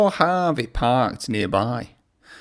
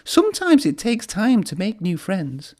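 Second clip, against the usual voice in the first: higher, gentle comfort; reassuring; 7 semitones higher than usual. A narrator's reading. Thomas comforting lonely Harvey, gently and higher. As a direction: soothe a sad friend. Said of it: "he said".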